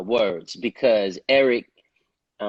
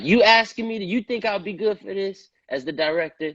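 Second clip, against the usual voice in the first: much higher, amazed double-checking; can't believe the director himself is asking; surprised; 9 semitones higher than usual. Retold or imitated. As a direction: caught off guard by the offer. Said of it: "I'm like".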